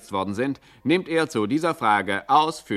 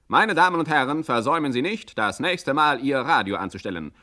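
radio teaser announcer; 6 semitones higher than usual. Acted. Announcer teaser for next episode.

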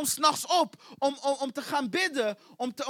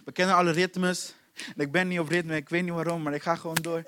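low, toneless going through the motions; bored, by-rote recital; monotone; 8 semitones lower than usual. Acted. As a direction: drone it mechanically on one low pitch. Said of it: "maar het is monotoon geworden".

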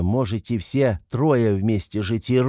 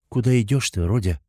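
ordinary delivery, nothing marked

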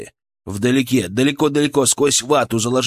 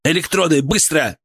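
raised urgent order; commanding; 4 semitones higher than usual. A narrator's reading. Healer ordering the young paramedic, urgent command. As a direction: fire off an order, pitched up. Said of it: "скомандовал я таким тоном".

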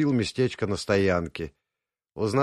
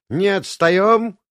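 громкий зов; 10 semitones higher, 8 dB louder, slower than usual. A narrator's reading. Гайдай зовёт группу.

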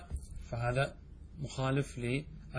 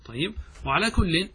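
usual speaking voice

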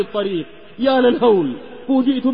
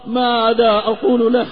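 usual speaking voice

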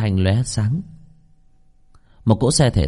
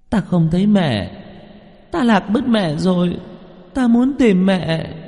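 put-on high, drawn-out childlike wail; tearful little girl; 10 semitones higher, slower than usual. Lost little girl cries to a passing driver for her mother, high tearful wail. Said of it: "rơi nước mắt nức nở".

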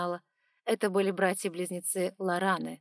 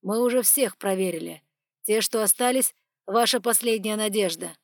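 louder, firm man's voice; stern male voice; 2 semitones higher, 7 dB louder than usual. Acted. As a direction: press the point coolly. Dragon presses the priestess.

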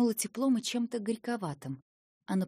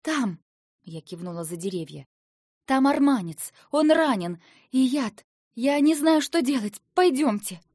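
выше и громче, сбиваясь от страха; торопить старика в панике; паническая мольба; 5 semitones higher, 8 dB louder than usual. A narrator's reading. Героиня в панике зовёт на помощь. (испуганно громко «Там арманец!»)

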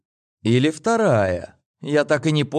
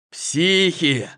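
возглас «Психи!» повыше и громче; вскрикнуть «Психи!»; cry out; 6 semitones higher than usual. Acted. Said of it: "вскрикнул я". (испуганный вскрик)

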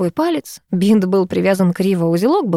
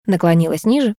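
plain reading voice

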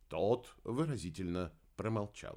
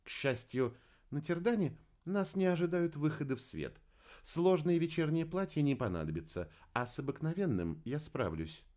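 composed, higher woman's voice; cool-headed reply; 6 semitones higher than usual. A narrator's reading. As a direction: answer coolly, unruffled, in a raised pitch. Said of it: "хладнокровно ответила Кира". (хладнокровно, высоким женским голосом)

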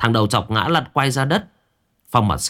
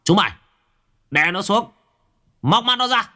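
barked order; 12 semitones higher than usual. A narrator's reading. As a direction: shout the order to pin him. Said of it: "Phong mặt sẹo liền quát".

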